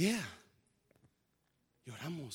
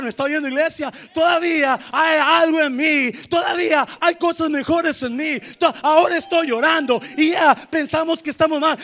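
loud, high shouted preaching; full-force preaching; 8 semitones higher, 12 dB louder than usual. Real, not acted. Pastor shouting hope to the congregation, fervent preaching. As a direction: cry it out to the church at full force.

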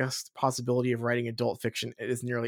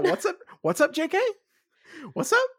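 mock husband voice; 3 dB louder, faster than usual. Voicing Kevin the husband.

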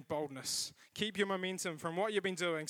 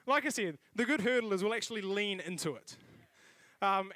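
ordinary talk

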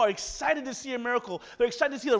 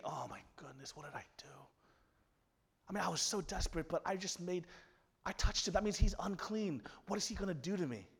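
hushed, softer panicked whisper; frightened whisper; 3 semitones lower, 11 dB quieter than usual. Acted. A woman afraid after touching the teacher, whispered. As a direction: whisper it in dread.

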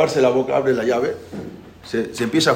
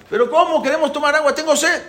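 high-pitched whining plea; acted kid demanding a drink; thirsty child's protest; 11 semitones higher than usual. Niño sediento protesta al padre. (niño quejándose, voz aguda)

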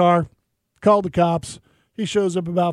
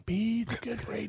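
much softer self-reminder, under the breath; muttered to self; 3 semitones higher, 10 dB quieter than usual. Retold or imitated. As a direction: say it quietly to yourself. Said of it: "I just was like".

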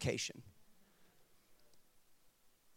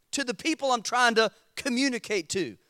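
normal talking voice

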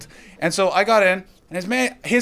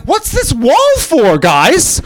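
high-pitched, naive tourist questioning; drawn-out, high-voiced innocent bewilderment; clueless tourist; 9 semitones higher, slower than usual. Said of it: "you're like".

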